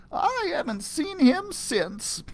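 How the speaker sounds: a sly hint of the old lady. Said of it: "she told us".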